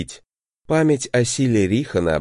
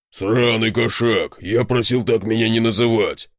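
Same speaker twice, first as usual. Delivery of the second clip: roaring bear. A narrator's reading. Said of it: "проревел он так".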